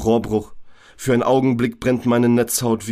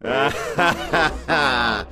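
inner voice laughing; 3 semitones higher than usual. Acted. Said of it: "fängt wieder an zu lachen".

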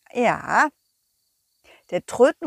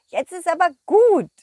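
annoyed scolding; 7 semitones higher, 6 dB louder than usual. Acted. Dog scolds worm.

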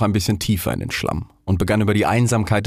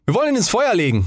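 put-on quick, high boy voice; 12 semitones higher, faster than usual. A narrator's reading. Boy proposing to burn the stick, quick and high. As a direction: pipe up fast and high like a lad. Second boy speaks in story.

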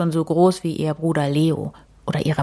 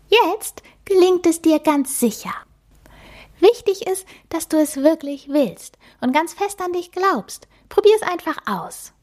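much higher, tiny and sweet mouse voice; a cute magic mouse introducing herself; little mouse voice; 11 semitones higher than usual. Acted. The magic mouse introduces herself to Emma, high and squeaky.

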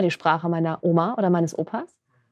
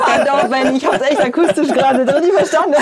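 laughing; 7 semitones higher, 7 dB louder than usual. Real, not acted. Laughing at his own mishearing.

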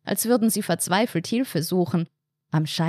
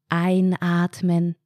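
slow, monotone relaxation instruction; breathing-exercise cue; much slower than usual. Acted. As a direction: stretch it out evenly, like a relaxation coach. Entspannte Atemübung im Kopf angesagt. (langsam und monoton, wie bei einer Atemübung)

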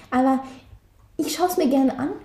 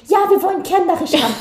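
mock casting announcement; 5 semitones higher, 5 dB louder, much faster than usual. Quoting the casting call's requirement.

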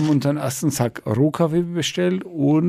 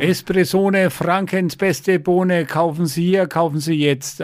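slow, raised radio ad pitch; advertising slogan; 4 semitones higher than usual. Pretend. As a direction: sell it like a jingle. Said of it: "mit diesem Spruch quälen wir ja die Hörer".

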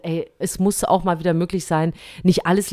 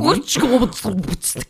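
sputtered nonsense syllables, trailing away; mock gibberish; 4 semitones higher, much slower than usual. Co-host imitating the horse's typed letters, spluttered gibberish. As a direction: splutter out a jumble of random letters. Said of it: "die sehr rätselhaften Buchstaben".

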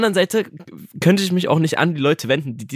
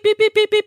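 high, sharp, loud mock beeps; imitated scanner beeps; 5 dB louder than usual. Mocking the cashier's scanner while packing in a rush. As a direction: beep like a checkout scanner going too fast. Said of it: "und so piep".

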